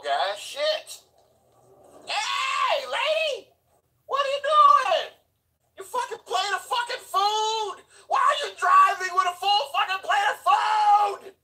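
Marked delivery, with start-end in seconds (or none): 2.0-11.3 furious high shout much louder; yelling at a driver. Real, not acted. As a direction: scream at the lady in the other car. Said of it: "yelling at her".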